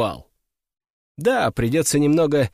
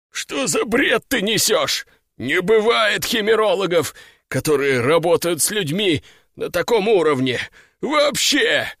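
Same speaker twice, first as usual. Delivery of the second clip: higher, incredulous fanatic voice; outraged prisoner, rising to a cry; agitated cultist; 4 semitones higher than usual. Acted.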